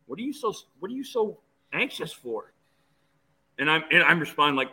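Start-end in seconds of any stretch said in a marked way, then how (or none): 0.1-2.5 lightly voiced, higher, softer father; gently puzzled questioning, as a remembered dad; imagined father. Father asking his nervous son, lightly imitated puzzlement. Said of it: "My father popped into my head and said".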